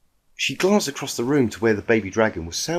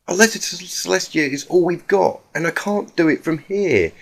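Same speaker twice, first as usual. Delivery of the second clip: Twilight voice, pleading; 7 semitones higher than usual. Acted. Twilight reasons with Applejack.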